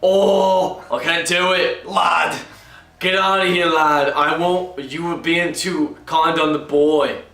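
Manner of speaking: bad British accent, gruff and drunk; drunken Brit calling out to a lad. Shown as a demonstration. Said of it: "he's gruff and drunk".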